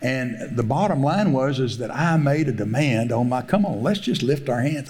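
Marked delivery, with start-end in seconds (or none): none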